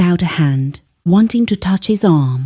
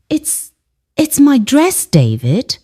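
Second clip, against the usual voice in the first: stammering; 7 semitones higher than usual. A narrator's reading. Hurt mother answering her angry son, mild stammer. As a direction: falter on the first word, then restart. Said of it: "I stammered".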